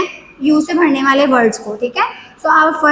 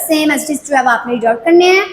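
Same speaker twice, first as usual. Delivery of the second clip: ordinary delivery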